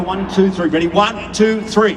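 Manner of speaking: loud cheerful count; rally the audience to chant; crowd count-in. Shown as a demonstration. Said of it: "say it after me".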